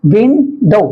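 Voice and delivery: "विन", pause, "डो"; विन-डो with a clear gap; syllable by syllable. Shown as a demonstration. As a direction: break विंडो into two beats. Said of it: "डायसिलेबिक".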